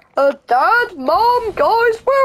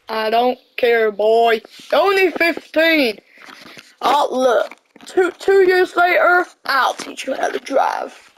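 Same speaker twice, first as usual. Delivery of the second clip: lower, curt father voice; a hint of a stern father, lower; stern dad; 5 semitones lower than usual. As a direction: shut the boy down. Dad refuses son.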